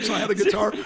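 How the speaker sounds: ordinary talk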